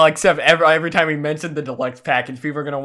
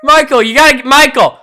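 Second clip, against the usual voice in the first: shouting; 9 semitones higher, 11 dB louder than usual. Acted. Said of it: "make a noise and slowly get quieter".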